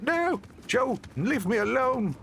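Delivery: high, drawn-out imitation of a pestered friend; mock whiny protest. Friend begging his old supervisor to leave him alone, mock protest. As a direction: plead in a hammed-up high voice.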